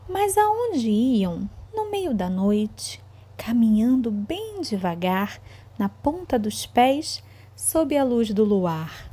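slow, suspenseful storytelling; reading to children. A narrator's reading. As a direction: draw the kids into a spooky tale. Teacher reads story to children.

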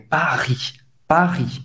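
PARIS with a gargled French R, silent S. Shown as a demonstration. Teacher letting the student hear it, gargled R in PARIS. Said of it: "esse gargarejo".

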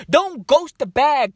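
normal talking voice

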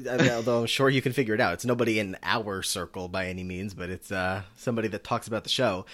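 ordinary talk, nothing marked

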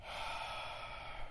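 long, weary exhale; withering sigh. Pretend. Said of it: "a long sigh before you get into it".

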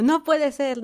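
excited disbelief. Retold or imitated. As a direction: imitate his overjoyed squeal. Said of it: "otra vez Enyuk así como".

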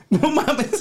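helpless giggling disbelief; laughing. Real, not acted. Friend laughing at the grandmother story, giggling.

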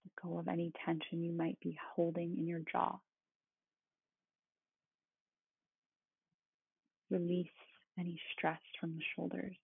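soothing, unhurried meditation guide; calm meditative tone. Acted. Leads a guided body-scan meditation.

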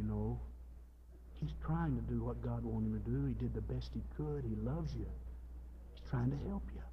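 soft, low, pleading counsel; gentle reassurance. Acted. Spirit speaking to that lady, gentle reassurance. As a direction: reason with her softly and tenderly.

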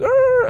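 high-pitched yell of alarm; sudden squeal-like yell; imitated cry from the rider; mock yell. Yelling as drunk rider arrives.

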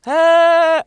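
high, loud shouted hey; shouty greeting. Pretend. Host playing the loud character who shouts hello. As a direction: blast out the hello. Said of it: "loud one that shouts hello all the time".

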